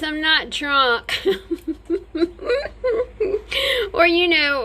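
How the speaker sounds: laughing at herself mid-sentence. Real, not acted. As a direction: breaks into giggles. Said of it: "if you hear me slurring words".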